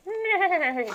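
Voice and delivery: high, loud squeaky insult; the bag squeals its insult; talking chip bag. Pretend. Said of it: "this bag went".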